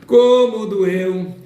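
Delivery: slow, high, loud comic whine; mock pained wail. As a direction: whine it like it really hurt. Mock pain after topic.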